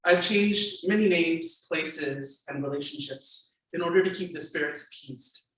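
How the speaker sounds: memoir reading. A narrator's reading. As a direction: read the passage to the audience with weight. Author reads memoir aloud.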